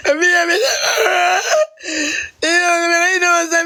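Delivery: high, tearful pleading; crying. Real, not acted. Man pleading that he is harmless, crying. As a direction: sob the words out. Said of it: "Stop crying now".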